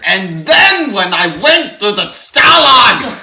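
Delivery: put-on loud, high, slow German old-man voice; hammy German painter shouting; German accent. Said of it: "I wish I knew how to do a German accent".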